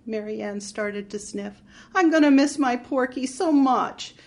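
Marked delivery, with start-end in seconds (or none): 1.9-4.2 sobbing. Acted. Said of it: "She started to sob in earnest".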